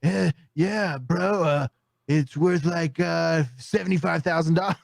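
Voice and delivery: lightly voiced dopey dude from the movie; Idiocracy dude voice. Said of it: "from the movie Idiocracy".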